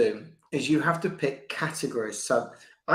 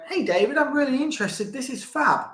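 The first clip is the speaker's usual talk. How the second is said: higher, eager mock client voice; imitating a keen client; 9 semitones higher than usual. Client gushing to David, in a higher mock-eager voice. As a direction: play an excited prospect, pitched up. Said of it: "These people come to me and go".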